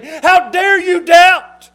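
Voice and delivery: booming, slow, high-pitched accusation; acted outraged shout, drawn out; stern mock rebuke. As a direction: thunder it down like a finger-wagging judge. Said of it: "with a stern finger looking down at us and saying".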